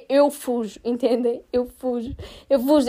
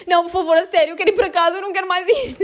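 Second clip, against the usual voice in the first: pleading; 5 semitones higher, faster than usual. Retold or imitated. Pleading to leave the test.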